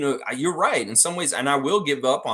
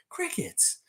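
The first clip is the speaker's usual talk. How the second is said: Crickets in a thin falsetto, much higher and softer; mocking squeak; 7 dB quieter than usual. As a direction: squeak out Crickets like a tiny echo. Repeated word, two ways.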